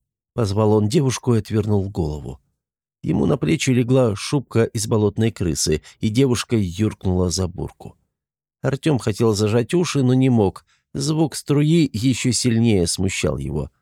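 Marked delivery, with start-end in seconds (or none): none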